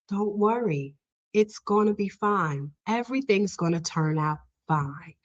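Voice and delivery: soft, retold comforting voice; gentle reassurance. Patient comforting the crying assistant, soft reassurance. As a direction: soothe someone in tears, keeping it soft.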